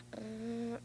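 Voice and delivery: breathy, voiceless brrrm; quiet car sound under the breath; hushed toy taxi; whispered engine noise. Pretend.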